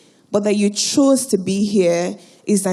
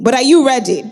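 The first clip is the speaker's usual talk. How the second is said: rising call to get the crowd going; hype call; 5 semitones higher, 4 dB louder than usual. Real, not acted. Host firing up the audience before the video, building hype shout.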